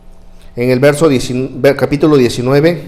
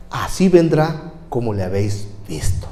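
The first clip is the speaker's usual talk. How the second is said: solemn hush; 5 semitones lower, 8 dB quieter than usual. Real, not acted. Solemn promise fades.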